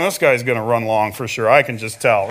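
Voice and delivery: low mock grumble; weary churchgoer's grumble. Long-time believer expecting an overlong sermon, mock grumbling. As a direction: drop the pitch into a resigned aside. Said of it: "and you're going".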